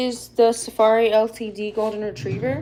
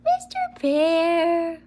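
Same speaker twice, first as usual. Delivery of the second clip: higher, slow drawn-out coo; pet-talk call; 8 semitones higher, much slower than usual. Real, not acted. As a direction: call the dog sweetly, stretching it. Calling dog in pet voice.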